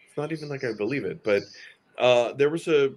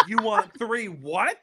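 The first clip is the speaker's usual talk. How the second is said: baffled; 10 semitones higher, slower than usual. Retold or imitated. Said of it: "And I was like".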